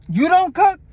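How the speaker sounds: shocked question. Retold or imitated. Driver shocked she doesn't cook, high disbelief. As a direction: gasp it out in disbelief. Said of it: "That's what I said".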